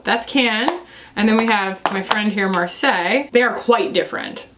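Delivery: normal talking voice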